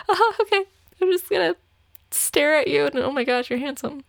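starry-eyed gushing over a handsome date; giddy swooning. Retold or imitated. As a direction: gush like a smitten girl. Swooning over date's looks.

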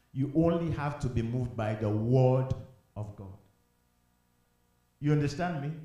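low, soft pastoral counsel; quiet, grave sermon delivery; hushed, earnest preaching. Real, not acted.

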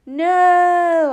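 huge high scream; yell as loud as you can. A narrator's reading. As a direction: scream it to stop them. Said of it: "I yell as loud as I can".

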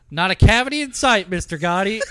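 a dentist loudly announcing the checkup result for any listeners; super loud dentist. Retold or imitated. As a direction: call it out so the whole office hears. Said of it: "super loud, this is what the dentist says".

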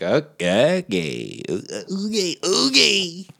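climbing to a high, silly pitch; mock dubious okay. Mock reaction to absurd plot.

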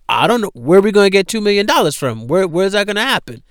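high, fast, loud panic; imitating the widows' frantic panic; panicked. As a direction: rattle it off in a frightened rush. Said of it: "they're like".

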